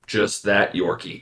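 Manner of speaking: ordinary talk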